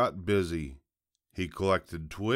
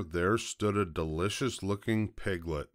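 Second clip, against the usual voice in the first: plain reading voice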